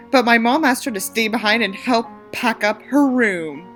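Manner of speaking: ordinary delivery, nothing marked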